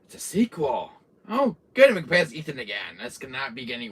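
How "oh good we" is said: high, loud mock cheer; sarcastic fake joy. Real, not acted. Sarcastic exclamation.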